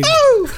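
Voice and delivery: very high squeaky "Au!" yelp; little cry. Pretend. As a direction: let out a shrill little Cumbia-style yelp. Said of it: "Mi gritillo qué, güey?".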